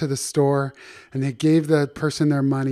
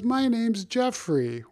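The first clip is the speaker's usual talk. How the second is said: high, slow, softer giraffe voice; gentle high voice for a giraffe; giraffe character voice; 8 semitones higher, 5 dB quieter, slower than usual. Acted. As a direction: introduce yourself as a storybook giraffe, pitched up and slow. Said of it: "He goes".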